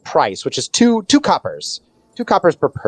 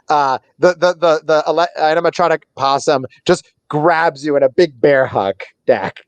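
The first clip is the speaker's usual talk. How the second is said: ordinary talk, nothing marked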